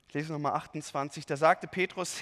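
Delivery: normal talking voice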